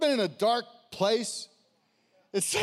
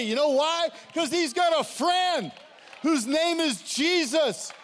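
rising, louder preacher's build to a shout; preaching at full force; 6 semitones higher, 3 dB louder than usual. Real, not acted. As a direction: whip the crowd up, higher and louder.

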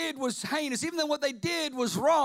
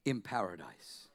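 lower, soft and gentle; tender promise; 11 semitones lower, 8 dB quieter than usual. Retold or imitated. Jesus turning to the thief, tender and quiet. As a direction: quietly assure him. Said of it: "Jesus ... turns his head and says".

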